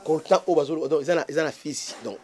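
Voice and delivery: usual speaking voice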